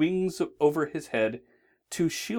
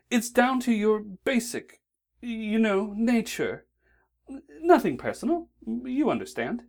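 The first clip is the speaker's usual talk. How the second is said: higher, prim angel voice; a polite, fussy angel explaining; lightly put-on angel voice; 11 semitones higher than usual. A narrator's reading. Aziraphale voiced in the story.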